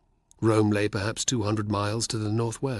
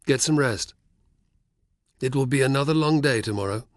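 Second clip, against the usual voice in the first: friendly but commanding; 4 semitones higher, 4 dB louder than usual. Acted. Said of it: "tone was friendly, but commanding".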